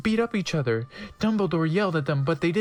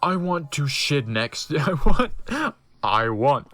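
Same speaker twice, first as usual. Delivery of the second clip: laughing; 4 semitones lower, 4 dB louder, slower than usual. Real, not acted. Reading breaks into laughter.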